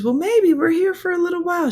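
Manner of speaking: put-on very high voice for the old lady; high mock old-lady reply; imitated elderly lady. Retold elderly lady answering.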